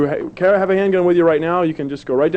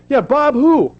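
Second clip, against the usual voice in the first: mock Bob Who; 11 semitones higher, 3 dB louder, slower than usual. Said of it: "like to get him and say".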